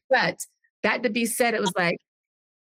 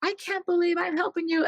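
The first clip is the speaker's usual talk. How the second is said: much higher, mock exasperated whine; imitating her sister's huff, pitched way up; 10 semitones higher than usual. Retelling her sister snapping at the wedding, mock exasperated whine. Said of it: "but she's like".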